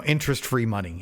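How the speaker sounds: normal talking voice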